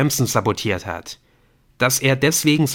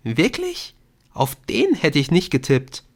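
high-pitched mild astonishment; surprised girl; 7 semitones higher than usual. A narrator's reading. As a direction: lift the voice high on the question.

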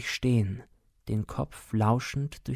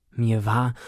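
usual speaking voice

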